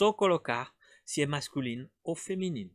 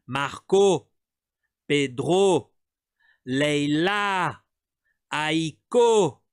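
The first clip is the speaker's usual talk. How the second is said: "Marco, Pedro, Leila, Aiko" each punched on the last syllable, louder; final syllable stress; 4 dB louder, much slower than usual. Shown as a demonstration. Teacher drilling the final stress of each name, louder. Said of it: "A última sílaba".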